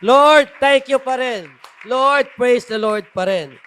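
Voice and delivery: shouted, high, slow thanksgiving; fervent shouted praise; loud praise. Shown as a demonstration. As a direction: cry out your thanks to the Lord. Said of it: "ang sasabihin natin".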